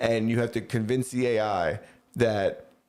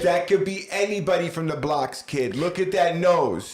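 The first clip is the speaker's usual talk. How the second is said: mocking comment; 6 semitones higher, 3 dB louder than usual. Mocking baby's looks.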